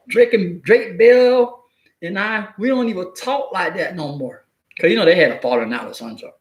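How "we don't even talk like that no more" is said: ordinary talk, nothing marked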